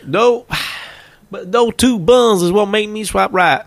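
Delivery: put-on high Texan old-timer voice; Texan grandfather. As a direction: play a wistful grandad from Texas. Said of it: "I've got a Texas accent".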